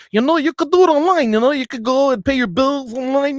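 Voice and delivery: imitating a teller telling a customer to go online; mocking bank teller. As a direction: mimic a breezy bank clerk pushing online.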